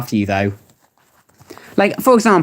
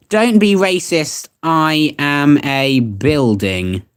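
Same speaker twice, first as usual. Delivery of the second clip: very drawn-out, sinking in pitch at the end; slow, deliberate dictation; 5 semitones lower, much slower than usual. Real, not acted. Streamer typing a viewer's requested search, slow and deliberate. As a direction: stretch each word out, as if typing it.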